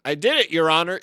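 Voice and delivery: hammed-up courtroom outburst; triumphant confession. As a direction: proclaim it to the judge. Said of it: "in the middle of a role play".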